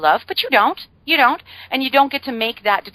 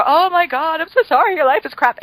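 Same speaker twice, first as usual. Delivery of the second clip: higher, hammed-up oh-my-God pity; mock gushing sympathy; 9 semitones higher, 4 dB louder than usual. Friend sits down and wallows along, gushing mock sympathy. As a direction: overplay the sympathy, a bit over the top.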